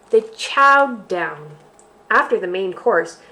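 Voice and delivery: slow, expressive picture-book reading; reading to children. Acted. Teacher reads a bear story aloud to kids. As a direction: read it out to little ones with relish.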